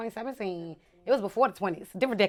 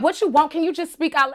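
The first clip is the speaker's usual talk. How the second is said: loud, impatient mock New Yorker; attitude; 6 semitones higher, 10 dB louder than usual. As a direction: imitate a fed-up barista, higher and louder. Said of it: "they gave me the most attitude".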